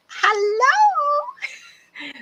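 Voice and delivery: high, loud gleeful greeting cry; screaming hello. Retold or imitated. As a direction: call across the room like greeting an old friend. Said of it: "all of a sudden they're screaming".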